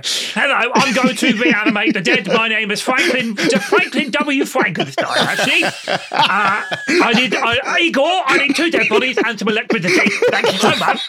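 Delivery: hammed-up lisping mad scientist; overacted lisp, laughing through it; lisping Frankenstein voice. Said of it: "use the lisp and the strange voice".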